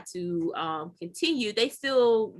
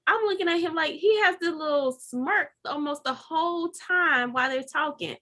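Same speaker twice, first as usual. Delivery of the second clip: ordinary talk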